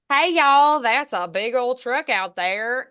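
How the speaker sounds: put-on loud, drawling Alabama Southern accent. Daughter imitating her dad's side of the family, Alabama accent. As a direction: do a big, slow Alabama twang. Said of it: "a dad from Alabama".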